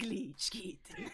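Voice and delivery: whispered pet name; breathy whispered name. Acted. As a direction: drop it to a whisper on kitten. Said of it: "I'm literally doing a voice".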